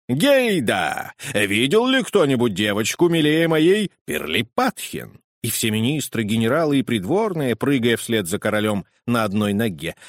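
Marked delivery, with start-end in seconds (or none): 0.1-5.2 higher, loud delighted king's voice; a proud father-king cheering over the cradle; joyful king. Acted. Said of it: "приговаривая".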